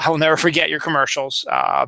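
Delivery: usual speaking voice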